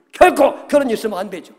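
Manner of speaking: acted-out remonstration; loud protest. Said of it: "막 큰 소리로 항변했다는 얘기는".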